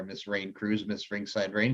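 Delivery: ordinary delivery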